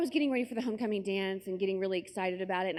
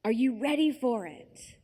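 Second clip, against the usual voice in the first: "it" given loaded emphasis in a higher, slow question; stress on IT; 4 semitones higher, 5 dB louder than usual. Retold or imitated. Boyfriend's friend quizzing a girl, pointed stress on "it". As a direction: lean meaningfully on "it" while asking slowly. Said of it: "he keeps emphasizing this word, it".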